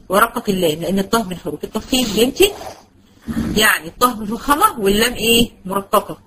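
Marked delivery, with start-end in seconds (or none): none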